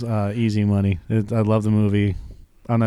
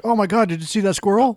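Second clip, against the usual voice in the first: much higher fake alarm; mock surprise; 10 semitones higher than usual. Driver faking a squirrel to jolt passengers, high mock alarm. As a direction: squeal it up high like faking a scare. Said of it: "hit the brakes like".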